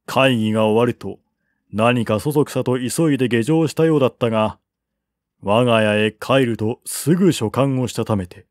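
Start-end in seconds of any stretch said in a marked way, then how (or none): none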